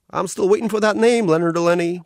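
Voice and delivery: cartoon otter greeting a new arrival, all sugary cheer; carnival otter voice. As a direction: gush like a cute carnival mascot.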